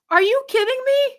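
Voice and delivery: high, loud disbelief; exasperated are-you-serious cry; incredulous outrage. Real, not acted. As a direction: shoot up in pitch, stunned and outraged. Outraged reaction to acceptance speech.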